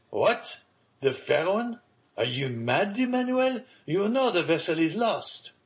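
high, rising questions of disbelief; incredulous. A narrator's reading. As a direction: challenge him as if he were mad. Father disbelieves the news.

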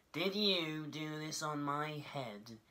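high, slow, accusing mole voice; little mole asking. A narrator's reading. Mole questions the passing dove, slow high accusing voice. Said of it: "he asked the horse".

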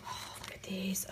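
soft breathy whisper of wonder. Real, not acted. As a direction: hush it as if sharing a secret. Hushed look at contents.

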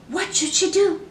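ordinary delivery, nothing marked

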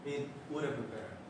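softly spoken "would have" linked as "would of"; reduced have. Shown as a demonstration. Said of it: "have로 발음하지 말고". ("would have"를 "우러브"로 줄여 조용히)

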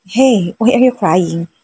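slow, drowsy half-asleep question; sleepy. Acted. Husband woken at night asks why she's crying, sleepy and slow. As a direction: ask it as if barely awake. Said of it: "he asked me in a sleepy tone".

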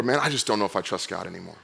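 low, subdued voicing of doubt; imitated weary doubter, quietly; disillusioned mutter. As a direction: drop soft and low, as if giving up. Voicing people who lost faith.

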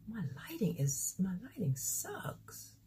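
whispered aside to herself. Real, not acted. Fixing her lighting, whispering an aside to herself. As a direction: mutter it under your breath.

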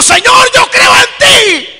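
high, drawn-out preacher's wail; crying out, acted. Preacher voicing the converted man's confession to Jesus, wailed cry. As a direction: cry it out slowly at full, high volume. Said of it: "Y llorando dijo".

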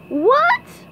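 very high, loud squeal of a question; SpongeBob yelling. Acted. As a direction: shriek it in a cartoon pipsqueak voice. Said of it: "yelled SpongeBob".

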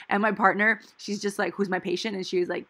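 ordinary talk, nothing marked

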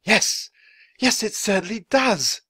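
high, loud delight, no real laugh; agree, overcome with joy; joyful agreement. A narrator's reading. Writer agreeing with Annie, joyful. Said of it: "laughing and wiping his eyes".

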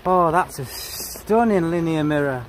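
normal talking voice

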